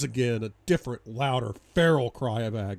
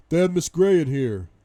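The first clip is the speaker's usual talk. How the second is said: loud, raised shout; calling out; 5 semitones higher, 10 dB louder than usual. Acted. Man in doorway calling his colleague, urgent shout. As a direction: call to a colleague across a distance.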